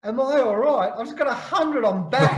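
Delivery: brushing off mum's worry, buzzing about the hundred; excited disbelief. Retold or imitated. Son on the phone to his mum, excited and laughing. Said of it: "And I'm going".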